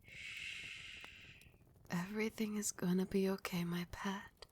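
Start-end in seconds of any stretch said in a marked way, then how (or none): 0.0-1.5 long, soft shush; soothing shush. Acted. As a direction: gently hush your beloved. Queen soothes her pet.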